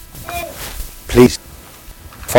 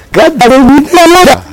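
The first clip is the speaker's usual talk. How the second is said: mock lofty; 11 semitones higher, 4 dB louder, slower than usual. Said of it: "punchline to congregation".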